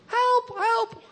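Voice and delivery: high, loud terrified yelp; mock panicked cry. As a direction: shriek like a cornered demon. Demon cries before Jesus.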